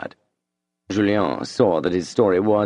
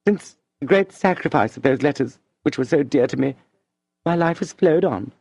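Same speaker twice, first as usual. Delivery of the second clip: higher, gentle voice of the beloved woman; Madame de Rênal's voice; 8 semitones higher than usual. A narrator's reading. Madame asks Julien to stay a friend, gently pleading.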